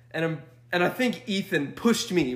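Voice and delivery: normal talking voice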